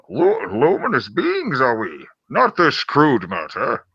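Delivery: slow, swooping comic impression; hammed-up hybrid movie-character voice; Yoda as Bane. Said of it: "if Yoda sounded like Bane".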